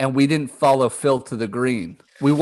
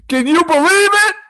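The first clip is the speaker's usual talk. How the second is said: high, loud mock disbelief; hammed-up squawk of indignation; imitated outraged fans; 5 dB louder than usual. Imitating guys griping about golf, mock outrage. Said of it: "They were like".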